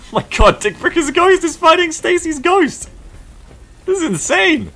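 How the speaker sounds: high-pitched disbelieving shriek; two ghosts fighting on screen, squealing in amazement; shocked outburst. Real, not acted. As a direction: yelp it out in total disbelief.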